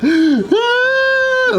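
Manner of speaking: very high, loud mock wail; loud put-on tantrum shriek; imitated toddler tantrum cry. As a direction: imitate a thwarted kid screeching. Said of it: "When he cries".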